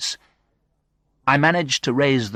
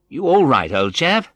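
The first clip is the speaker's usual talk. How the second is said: high, loud friendly check; hearty friend; 7 semitones higher, 5 dB louder than usual. A narrator's reading. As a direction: greet a patient heartily. Paul greets injured friend.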